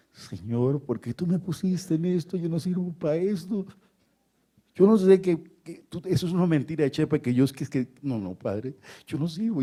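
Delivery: high, whining mock sob; imitated childlike crying. Preacher mimics Don José pleading with God under the desk, crying. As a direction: plead like a tearful child, pitch jumping. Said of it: "Y lloraba como un niño".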